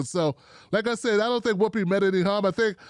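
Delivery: ordinary talk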